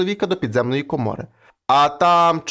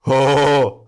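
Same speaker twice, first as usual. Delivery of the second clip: sudden loud cry; a startled roar; devil cries out; 4 semitones lower, 5 dB louder than usual. Acted. Devil in hell hears the blow and cries out loud. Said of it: "закричав чорт".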